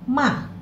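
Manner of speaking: ma falling sharply; fourth tone ma. Shown as a demonstration. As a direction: drop ma hard from the top. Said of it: "kita tambahkan nada yang keempat".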